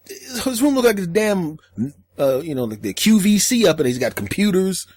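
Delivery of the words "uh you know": usual speaking voice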